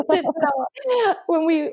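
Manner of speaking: high, slow laughing protest. Real, not acted. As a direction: laugh through the denial.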